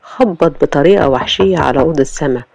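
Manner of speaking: ordinary delivery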